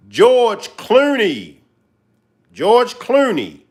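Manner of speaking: "George Clooney" drawn out, high and punched; stress on Clooney. Acted. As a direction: hammer the name "George Clooney" slowly. Emphatic hype repetition.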